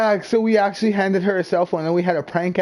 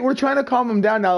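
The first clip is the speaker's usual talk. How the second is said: ordinary talk, nothing marked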